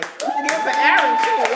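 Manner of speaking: high, loud cheer for the winner; loud celebratory call; excited shout-out. Real, not acted. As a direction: whip up applause at full voice. Calling for applause for winner.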